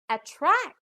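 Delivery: "attract" demonstrated with a high, emphatic TRACT; stress on second syllable. Shown as a demonstration. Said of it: "put the stress on the second syllable".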